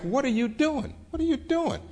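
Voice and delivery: retold bewildered protest, pitched high and climbing; baffled disbelief. Marshall startled as child bites rind.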